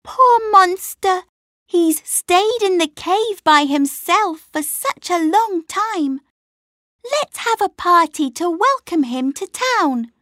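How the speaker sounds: put-on a small girl full of sympathy; a little girl urging the town to throw a party, sympathetic. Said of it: "said a little girl".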